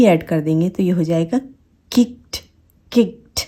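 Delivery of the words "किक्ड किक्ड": high "kikt" for किक्ड; one-syllable किक्ड ending in t; soft T ending. Shown as a demonstration. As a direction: clip a small t onto किक्ड, no vowel. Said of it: "वो होता है ठ".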